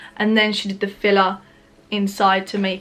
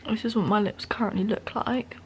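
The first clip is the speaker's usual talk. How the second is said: soft, hushed voice; quiet at night; 6 dB quieter than usual. Real, not acted. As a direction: keep it down, parents sleeping. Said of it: "I have to be really quiet because".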